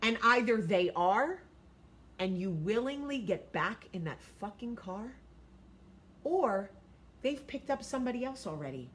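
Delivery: normal talking voice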